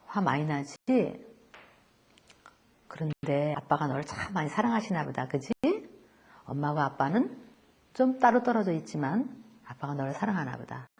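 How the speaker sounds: put-on consoling a boy about his absent father, softly; soft comforting voice. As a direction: softly reassure a hurt child.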